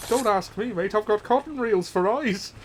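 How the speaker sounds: a jokey Irish voice; Irish accent. Pretend. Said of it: "this is an Irish accent".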